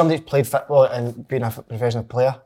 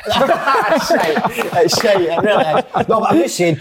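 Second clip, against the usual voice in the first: high, laughing protest; 7 semitones higher, 3 dB louder, slower than usual. Real, not acted. Ex-player laughing off a mate's cafe role-play, high-pitched. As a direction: talk through real laughter.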